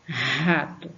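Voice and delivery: "Rato" opening on a forceful h-like R; "Rato" with a long aspirated R; strong R. Shown as a demonstration. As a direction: lean hard on the first syllable of "Rato". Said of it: "o R já tem um som forte".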